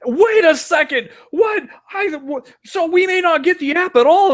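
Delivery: high-pitched cartoonish panic of outrage; mock apoplectic outburst. Co-host imitating angry commenters over the app, mock apoplectic. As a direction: imitate fans losing it, voice shooting way up. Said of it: "apoplectic over this, going".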